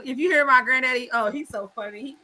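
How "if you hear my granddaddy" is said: ordinary delivery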